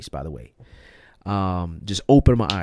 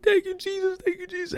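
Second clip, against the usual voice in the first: very high, choked sob of thanks; crying thanks. Retold or imitated. Crying alone in his room, thanking Jesus through sobs. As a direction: weep it out to Jesus, breaking high. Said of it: "I'm crying".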